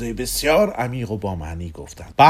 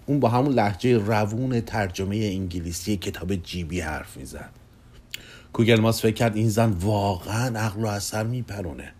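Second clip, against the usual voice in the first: plain reading voice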